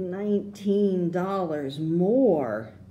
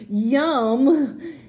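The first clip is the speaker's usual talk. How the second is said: high, loud delighted yum; 7 semitones higher, 5 dB louder than usual. A narrator's reading. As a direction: savour it like a treat. Child tasting favorite gum.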